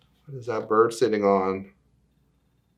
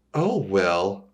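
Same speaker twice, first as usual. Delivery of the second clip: ordinary delivery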